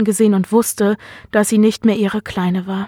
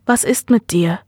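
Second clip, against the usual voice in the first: plain reading voice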